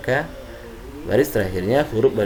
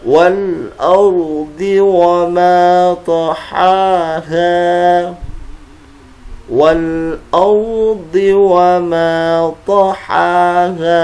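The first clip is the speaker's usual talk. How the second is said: slow, careful Quran recitation; over enunciating; 8 semitones higher, 9 dB louder, much slower than usual. Shown as a demonstration. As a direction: chant each syllable deliberately. Recites verses, each twice.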